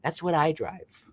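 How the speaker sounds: hammed-up entitled guy; snobby complainer. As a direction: play a spoiled brat sneering at the car. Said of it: "he's like".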